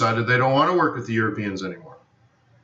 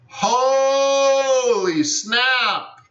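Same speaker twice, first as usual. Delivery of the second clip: very slow, much higher holy snap, held long; drawn-out amazement; 10 semitones higher, much slower than usual. Real, not acted. Teacher marvels at the target hit, in a very slow, high cry. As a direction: savour the shock as you stretch every sound.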